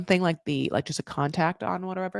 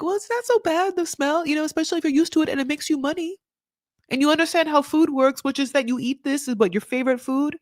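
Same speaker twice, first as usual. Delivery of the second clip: lightly voiced, higher reasoning tone; voiced goat farmer; 7 semitones higher, faster than usual. Goat farmer defending the smell, lightly voiced reasoning. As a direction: reason with her in a slightly raised pitch. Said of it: "The man's like".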